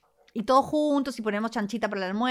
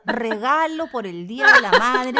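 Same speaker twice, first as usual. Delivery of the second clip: laughing excited prompt; eager urging; 3 semitones higher, 9 dB louder than usual. Real, not acted. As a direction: egg her on to tell. Eagerly urging a juicy story.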